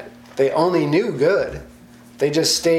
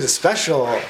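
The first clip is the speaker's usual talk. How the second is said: usual speaking voice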